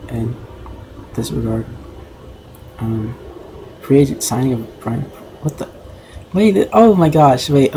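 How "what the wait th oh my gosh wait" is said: higher and louder sudden surprise; jolted by something unexpected, then settling; startled. Real, not acted. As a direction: caught off guard, blurting it out. Caught off guard mid-read.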